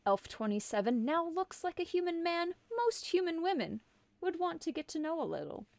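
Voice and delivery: normal talking voice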